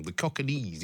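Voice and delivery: Cockneys in three syllables, like Polynesians; mispronounced Cockneys. Shown as a demonstration. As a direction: say Cockneys wrongly as COCK-a-neez. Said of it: "pronounces it all wrong".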